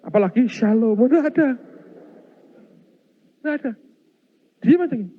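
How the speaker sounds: high, loud and heated; worked-up preacher telling a scary tale; rising excitement. Real, not acted. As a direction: build it up, pitch climbing. Describes the silent ghost, building excitement.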